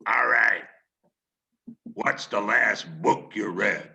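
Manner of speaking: gruff, clipped editor's bark; Bradlee's voice. Retold or imitated. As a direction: play the brisk all-business boss. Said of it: "I'll do his voice here 'cause he really did talk like this".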